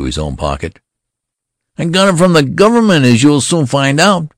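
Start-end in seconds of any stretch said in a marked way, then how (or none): 1.8-4.3 put-on high, loud indignant boy's retort; defiant shout. A narrator's reading. Boy general defying the colonel who pocketed his papers, high loud. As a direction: fling it back as a haughty threat.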